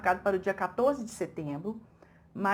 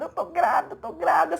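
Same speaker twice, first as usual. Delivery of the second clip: higher, lightly loud joyful announcement; ecstatic announcing; 6 semitones higher, 3 dB louder than usual. Retold or imitated. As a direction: imitate her overjoyed news. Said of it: "ela naquele êxtase todo". (anunciando em êxtase, mais agudo)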